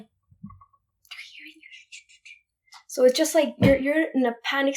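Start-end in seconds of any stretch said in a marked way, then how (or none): 0.0-2.8 hushed, frightened whisper. Retold or imitated. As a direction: whisper it as if someone is listening. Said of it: "And they were like".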